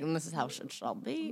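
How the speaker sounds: hammed-up English royal decree; Queen of England. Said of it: "The queen was like".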